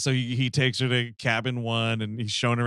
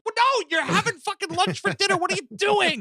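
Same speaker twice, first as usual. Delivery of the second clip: high-pitched, incredulous protest; mock outrage. Viewer scolding a character's sandwich dinner, mock outrage. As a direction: squeal it in comic indignation.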